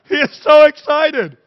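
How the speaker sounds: high, loud laughing delight. Real, not acted. Vlogger laughing at a man fooled by the prank, high and loud. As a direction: burst out laughing as you say it.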